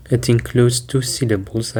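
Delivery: normal talking voice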